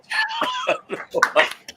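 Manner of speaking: helpless high-pitched cackle; high burst of laughter. Real, not acted. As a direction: friend cracking up at the rant, laughing hard. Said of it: "I'm cracking up over here".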